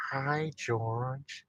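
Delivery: soft, put-on American accent. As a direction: greet him in an American accent. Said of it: "He loves my American accent".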